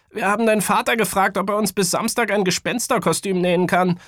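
put-on high, fast child character; eagerly explaining boy. A narrator's reading. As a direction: rattle off the explanation as a kid. Voices Matz explaining to Kaya. (eifrig erklärender Junge, hohe Stimme)

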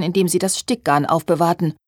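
ordinary delivery, nothing marked

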